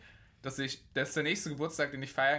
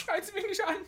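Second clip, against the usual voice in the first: very high, loud squeaky outrage; mock indignant doctor; 5 dB louder than usual. Joking about the doctor's reaction to being yelled at, squeaky mock outrage. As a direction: play the offended caller, hammed up.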